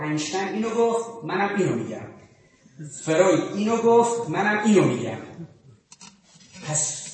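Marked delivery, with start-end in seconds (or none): 3.0-5.2 louder, hammering the point; insistent, louder restatement; emphatic loud repeat. Shown as a demonstration. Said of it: "بریم بگی که آقا".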